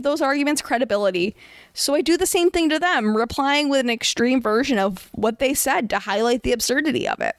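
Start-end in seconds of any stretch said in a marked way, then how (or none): none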